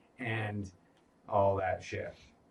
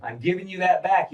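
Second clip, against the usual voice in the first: louder and higher, driving the point home; emphatic repeat; 6 semitones higher, 6 dB louder than usual. Retold or imitated. Voicing the message to Rhaenyra, louder repeat. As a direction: say it again with force.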